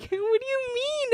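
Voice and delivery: very high-pitched puzzled question; retold bewilderment. Daughter answering her dad's question, high bewildered voice. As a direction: squeak out the question, playing dumb. Reenacts reply to dad's scolding.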